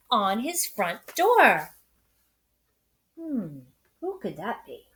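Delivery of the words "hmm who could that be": wondering hmm. Acted. Reader wonders aloud to children.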